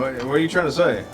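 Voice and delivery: ordinary talk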